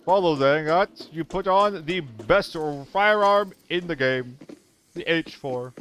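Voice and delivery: hammed-up gaming-mentor teacher voice. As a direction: lecture a newbie like a smug mentor. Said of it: "Trying to talk like Ed".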